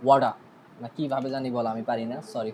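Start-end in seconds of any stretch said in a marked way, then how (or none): 0.0-0.3 American accent on ওয়াডা, wah-da with a tapped middle; a light American accent on ওয়াডা; American water. Shown as a demonstration. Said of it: "ওয়াটারে তারা বলে".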